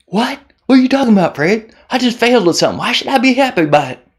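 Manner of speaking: high, fast mock Southern whine; Southern accent. Imagined viewer scoffs at Fred, high Southern twang. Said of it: "I don't know why you're Southern".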